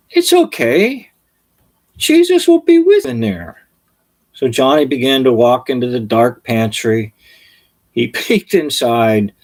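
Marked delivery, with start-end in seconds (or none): 0.1-3.7 reassuring mother. Retold or imitated. Mother reassuring little Johnny, high and soothing. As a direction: coax the boy gently, pitched up.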